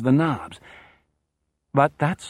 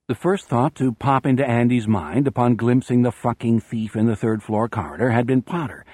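plain reading voice

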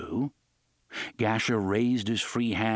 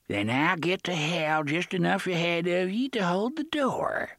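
chummy pirate; 7 semitones higher than usual. A narrator's reading. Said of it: "and chuckled chummily".

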